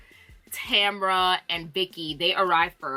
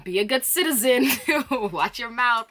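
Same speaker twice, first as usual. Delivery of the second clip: a hint of a teasing scold, louder; scolding friend; 5 semitones higher, 4 dB louder than usual. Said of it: "Phaedra was telling her".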